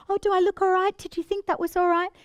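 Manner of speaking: high, anxious seeking reassurance; insecure giggler. Shown as a demonstration. Giggler asking others if she looks right, high anxious. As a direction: ask for approval in a high small voice. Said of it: "in a very kind of low place".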